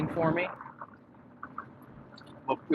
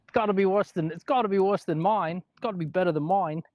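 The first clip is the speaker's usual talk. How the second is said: high-pitched attempted Aussie accent; badly faked Aussie protest; poor Australian accent; 6 semitones higher than usual. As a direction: push the pitch up and fake Australian vowels. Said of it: "Yeah, that's not good".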